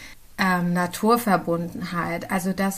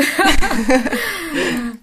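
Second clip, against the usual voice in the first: loud, high burst of real laughter; laughing; 8 semitones higher, 8 dB louder than usual. Real, not acted. Laughs about mood being saved.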